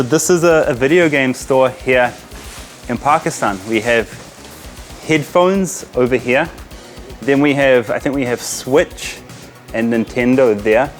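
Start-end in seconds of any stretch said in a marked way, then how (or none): none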